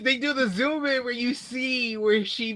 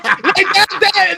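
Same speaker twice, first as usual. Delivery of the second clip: usual speaking voice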